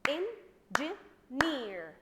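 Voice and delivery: "engineer" in three loud, slow, separate beats; clapped-out syllables. Shown as a demonstration. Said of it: "Clap with me the word".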